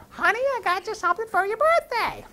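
very high, hammed-up wifely singsong; gushing wife. Wife bringing her husband a birthday present, gushing. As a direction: trill it excitedly in a high voice.

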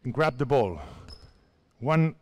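usual speaking voice

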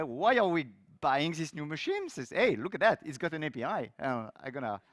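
usual speaking voice

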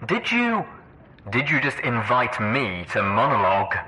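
surprised but hardly displeased; pleasantly taken aback, starting high; Red Eye. A narrator's reading. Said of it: "sounded surprised, although hardly displeased".

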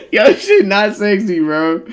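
high, loud, cracked-up protest; laughing hard. Real, not acted. Streamer laughing at his own sexy claim, high and loud. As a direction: squeal it out through real laughter.

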